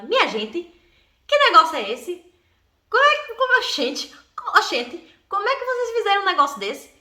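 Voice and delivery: lightly done Nordestino aunt, oxente and all; a hint of the aunt's Northeastern Brazilian accent, amazed. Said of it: "Minha tia com aquele sotaque bonito, né, nordestino".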